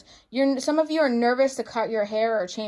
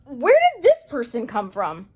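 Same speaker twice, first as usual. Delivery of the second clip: mock shocked; 5 dB louder than usual. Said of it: "you're gonna be like".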